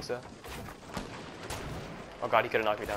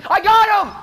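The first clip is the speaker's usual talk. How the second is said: triumphant shout; 12 dB louder than usual. Real, not acted. Scoring a kill.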